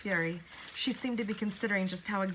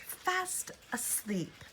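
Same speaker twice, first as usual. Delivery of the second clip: very slow, soft, high creepy voice; slow eerie mockery; 6 semitones higher, 3 dB quieter, much slower than usual. A narrator's reading. Woman mocks Elizabeth about Norbridge, slow and eerie. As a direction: drawl it like a sly witch. Villain mocking answer.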